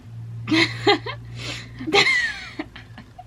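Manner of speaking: high, sobbing laughter in bursts; long tearful fit of sobs and giggles; crying laugh. Real, not acted. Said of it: "I just cried for that".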